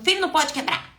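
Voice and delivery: put-on loud, high yell at the child; harsh scolding. Shown as a demonstration. The wrong way: parent yelling at child not to break it. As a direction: snap it at him like an angry parent. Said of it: "não tô falando".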